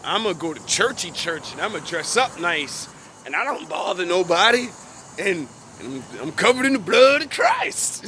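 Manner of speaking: mocking a smug churchy churchgoer, laughing by the end; hammed-up pious voice that breaks into a laugh. Mocking a self-satisfied churchgoer for his viewers, laughing. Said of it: "Things that the world does".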